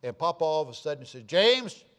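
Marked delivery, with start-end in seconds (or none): 1.2-1.8 sharp, loud call of a name, pitched higher; grandfather's stern call. Retold or imitated. Grandfather calling out his grown son, stern loud call. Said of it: "different voice than normal".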